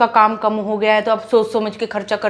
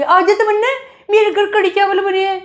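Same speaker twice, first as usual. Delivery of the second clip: much higher, sing-song sweet invitation; mock the friend's sugary calling out; 10 semitones higher than usual. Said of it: "इसको इतना प्यारा समझती थी". (सहेली की मीठी आवाज़ की नकल, काफ़ी ऊँची)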